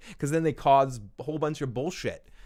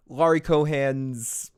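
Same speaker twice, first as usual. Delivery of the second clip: usual speaking voice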